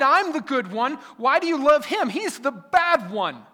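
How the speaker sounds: put-on high, loud sulky child pleading; older son whining to his father like a spoiled child; childish tantrum. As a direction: throw a petulant kid's tantrum at dad. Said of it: "He's being a child".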